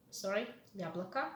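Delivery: usual speaking voice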